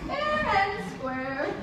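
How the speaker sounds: very slow, savoured words; slow, smug emphasis; drawn out. Acted. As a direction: stretch each word out smugly. Queen savours her trick.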